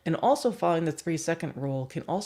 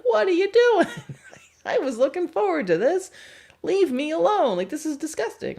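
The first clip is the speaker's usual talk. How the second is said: high, loud indignant protest, laughing through it; upset dinner guest; 13 semitones higher, 7 dB louder than usual. Retold or imitated. Guest to the person patting their head, high indignant protest. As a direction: squeal at someone who is ruining your plate. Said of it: "And you're like".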